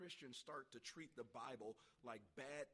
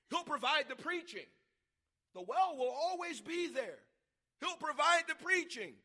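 high, very loud pulpit shout; belting it out to the congregation; shouted preaching; 12 semitones higher, 17 dB louder than usual. Real, not acted. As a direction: thunder the promise at full force. Preacher shouting promises at full force.

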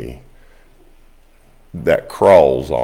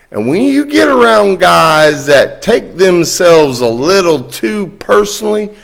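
excited friend; 11 semitones higher, 8 dB louder than usual. Retold or imitated. Friend retold, laughing and cutting up.

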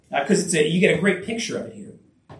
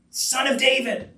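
higher-pitched call, no real shout; louder repeated cry; 7 semitones higher than usual. Acted. Blind man crying out to Jesus again, mock louder cry. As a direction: lift the pitch as if calling out. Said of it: "even louder".

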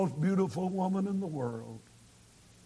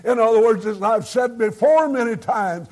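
rising, louder preaching push; fervent preaching; 6 semitones higher, 6 dB louder than usual. Acted. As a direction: exhort the congregation with full force. Preacher continues at full force.

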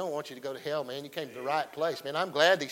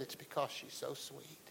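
much softer, nearly breathed repeat of her words; hushed repeat; 15 dB quieter than usual. Retold or imitated. Pastor repeating the dying woman's words, hushed. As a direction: drop almost to a hush as you say it again. That's what she said.

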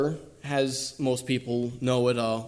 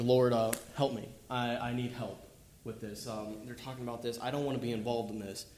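quiet plea; 8 dB quieter than usual. Retold or imitated. Acting out a quiet plea in prayer.

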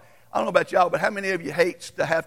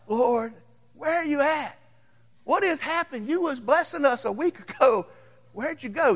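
pleading prayer; 9 semitones higher than usual. Retold or imitated. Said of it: "And I'm like".

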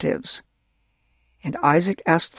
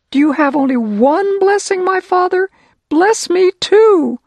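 high, loud, slowed begging near tears; tearful plea; 13 semitones higher, 6 dB louder than usual. Acted. Esau begging his blind father, high and tearful. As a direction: plead much higher and louder, close to crying. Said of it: "And Esau started to cry".

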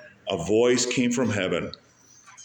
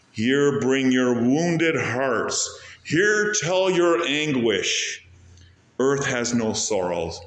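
very slow, drawn-out hymn lines; quoting a hymn; 3 semitones higher, much slower than usual. Acted. Preacher to the congregation, slow hymn lines. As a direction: lay each line out slowly, like a hymn. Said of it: "That song, maybe you heard of this song".

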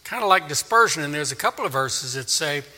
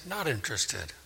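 very soft, mumbled brush-off; quiet, uninterested shrug; dismissive; 15 dB quieter than usual. Acted. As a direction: wave it away under your voice. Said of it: "and they say".

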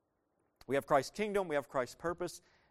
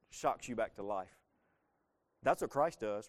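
ordinary talk

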